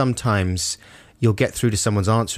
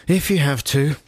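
higher, grudging reply from a train passenger; begrudging; 5 semitones higher than usual. Acted. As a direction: give in reluctantly. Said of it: "said man begrudgingly picked it up".

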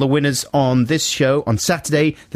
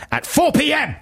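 raised high, stern official warning; mock-strict deadline warning; 13 semitones higher than usual. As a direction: lay down the rule with mock severity.

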